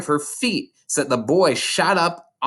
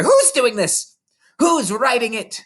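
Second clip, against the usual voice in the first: much higher, louder frantic yell; 11 semitones higher, 4 dB louder than usual. A narrator's reading. Headmistress yelling frantically at the room, higher and louder. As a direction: yell at nobody in panic. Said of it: "she yelled".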